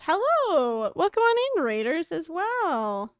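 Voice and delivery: high, swooping sing-out greeting; excited welcome. Real, not acted. Streamer welcomes arriving raiders, high swooping excited greeting. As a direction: sing out the welcome, stretching the ending.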